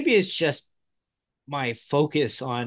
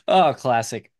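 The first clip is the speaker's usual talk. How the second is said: normal talking voice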